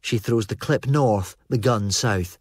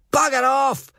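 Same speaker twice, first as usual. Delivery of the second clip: angry yell. Acted. Armed Fraser yells at Felpy to back off, much higher and angry. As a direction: bark him away. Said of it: "Fraser yells".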